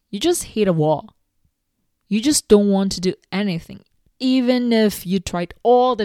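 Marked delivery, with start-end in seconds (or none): none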